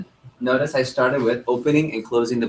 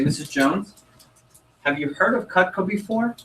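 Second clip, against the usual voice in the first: upbeat sales pitch; 8 semitones higher than usual. Shown as a demonstration. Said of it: "this is how I say it".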